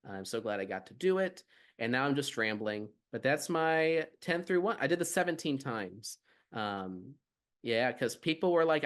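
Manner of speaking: usual speaking voice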